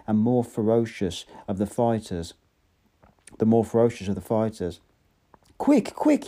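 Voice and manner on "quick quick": very high urgent yell; frantic high yell. A narrator's reading. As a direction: hurry them with a yelp. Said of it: "yelled Bernard".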